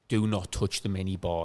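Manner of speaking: slow, hushed warning between roommates; low and slow, like a serious pact; mock stern warning. Roommate warns his mate off the minibar, slow and hushed. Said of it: "me and Con were like".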